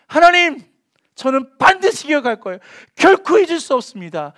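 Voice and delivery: loud, high impassioned cry; fervent vow. Acted. Psalmist crying his vow to God, loud and fervent.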